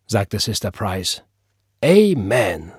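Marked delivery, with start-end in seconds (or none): 1.8-2.7 loud, drawn-out amen; fervent amen. Acted. Church sister echoing praise after scripture, fervent. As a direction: let the assent ring out devoutly. Drawn-out second congregational response.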